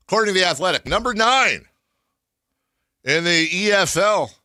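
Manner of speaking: proud hype over the team's ranking; excited. Real, not acted. Hyping his team's ranking.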